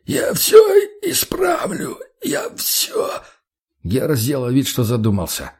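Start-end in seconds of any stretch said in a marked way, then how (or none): 0.1-3.2 choked, voiceless squeal of pleading; terrified, tearful croak; strangled wheeze. A narrator's reading. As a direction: promise desperately through a squeezed throat. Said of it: "прохрипел тот".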